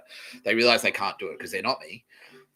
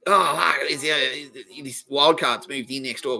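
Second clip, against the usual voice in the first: higher, louder imitation of an alarmed caller; mock alarm; 6 semitones higher, 6 dB louder, slower than usual. Neighbour phoning about wild cards next door, mock alarm. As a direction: raise the voice in put-on dismay.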